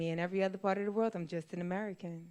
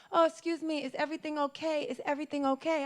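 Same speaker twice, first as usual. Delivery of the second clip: higher, sweet polite lady; higher polite woman asking if all is well; 7 semitones higher than usual. Retold or imitated. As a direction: voice the courteous helper, pitched up. Voicing polite Japanese woman.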